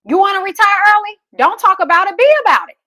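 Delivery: rally them to act now; a preacher's climbing fired-up challenge; fired-up push. Real, not acted. Closing motivational push.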